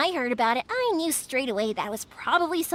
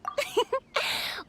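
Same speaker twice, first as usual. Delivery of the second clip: soft laugh; 8 semitones higher, 4 dB quieter than usual. Real, not acted. Laughs after long speech.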